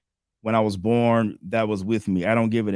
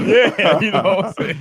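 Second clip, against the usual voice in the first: laughing; 10 semitones higher, 5 dB louder than usual. Real, not acted. Still laughing at joke.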